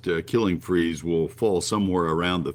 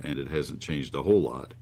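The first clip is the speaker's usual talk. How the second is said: normal talking voice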